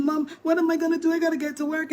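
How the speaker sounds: high, loud, fast panic; panicking. Retold or imitated. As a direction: rush it out, upset and scared. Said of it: "And she's panicking".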